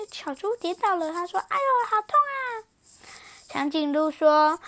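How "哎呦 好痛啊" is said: high yelp of pain; hurt piglet. Acted. Piglet crying out after falling. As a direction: yelp like a little pig that fell.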